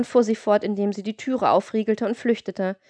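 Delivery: ordinary delivery, nothing marked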